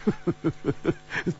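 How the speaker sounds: breath-driven staccato laugh; amused chuckle. A narrator's reading. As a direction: laugh softly in short puffs. Amused at friend's shock.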